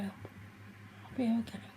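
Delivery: slow whispered self-reassurance; soft slow whisper of comfort. Real, not acted. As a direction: whisper it slowly to calm yourself. Self-reassurance after crying.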